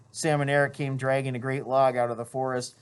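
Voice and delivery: ordinary delivery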